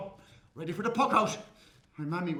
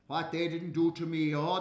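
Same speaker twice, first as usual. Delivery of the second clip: ordinary delivery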